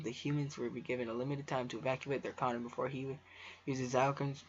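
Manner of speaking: normal talking voice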